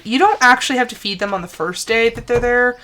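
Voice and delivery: usual speaking voice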